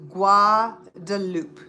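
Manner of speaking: "Guadalupe" drawn out, ending -lup; very slow French Guadalupe. Shown as a demonstration. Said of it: "Not Guadalupe".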